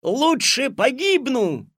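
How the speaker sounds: high, loud defiant cry; defiant shout. Acted. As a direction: shout back while struggling. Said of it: "закричал Алёшка".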